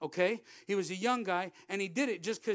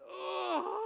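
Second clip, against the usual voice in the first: high, drawn-out mock sob; imitated grieving wail; 9 semitones higher than usual. Coworker letting the pain lift off, imitated wail. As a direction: act out a release of grief. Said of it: "he started to grieve".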